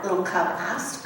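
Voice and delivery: ordinary delivery, nothing marked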